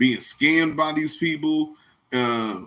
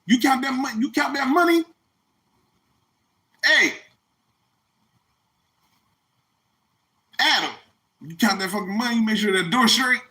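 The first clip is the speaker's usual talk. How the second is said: high mock boss-like Jesus voice; hammed-up Jesus bossing the disciples, pitched up; 8 semitones higher than usual. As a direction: parody of Jesus giving orders to his followers. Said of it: "You ain't never heard Jesus say".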